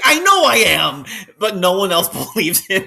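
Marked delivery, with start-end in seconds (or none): 0.0-1.0 high, loud, drawn-out admission; hammed-up loud lament; mock exasperated cry. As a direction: wail it out in comic despair. Acting out the idiot angel's reply.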